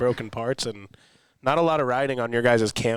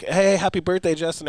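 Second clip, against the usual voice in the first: higher-pitched imitated birthday hail; cheery mock greeting; 5 semitones higher than usual. Signing in to tease Justin, cheery mock greeting. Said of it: "and be like".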